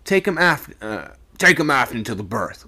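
loud, curt command; abrupt order. A narrator's reading. Second mate abruptly ordering the boy carried aft, curt and loud. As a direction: cut the order short and firm. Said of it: "ordered the second mate, a bit abruptly".

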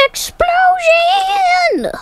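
high, slow drawn-out shout. Pretend. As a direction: yell it out with glee. Shouting game wish.

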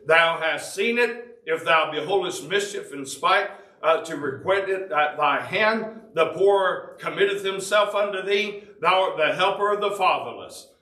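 scripture reading. Acted.

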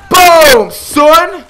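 very loud, high-pitched gloating yell; triumphant shout. Real, not acted. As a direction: shout it in triumph over a kill. Celebrates a kill.

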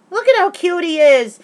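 high, loud gushing squeal; gushing excitement. Real, not acted. Showing viewers her Shane photo, gushing squeal. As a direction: gush over him like a giddy fan.

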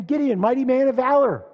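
loud, high heralding call; booming pulpit proclamation; mighty proclamation. Acted. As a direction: hail him as from heaven. God hails the hiding Gideon.

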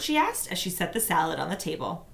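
plain reading voice

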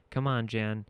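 ordinary delivery

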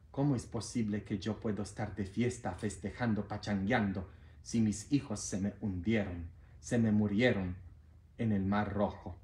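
low, soft, mournful God, a touch of tears; grieving God. Acted. God answering the angel, low and softly grieving. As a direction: speak low and hushed as a heartbroken father. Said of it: "Y Dios dice".

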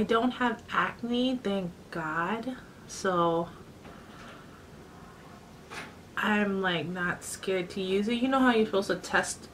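muffled through fingers; speaking behind hand; hand over mouth. Real, not acted. Seen 0: Applying face mask, fingers cover mouth at times while talking.

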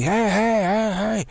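high, drawn-out mock yell; imitated yelling. Paraplegic man hailing a passerby, imitated yelling. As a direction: call out high, imitating someone hailing you. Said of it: "yelling at me".

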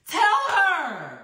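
ordinary delivery

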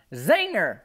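high, loud giggly Zinger; gleeful yell. Retold or imitated. Granny crowing at the boy after her prank, loud and high. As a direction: crow it like a prank landed. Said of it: "She's yelling".

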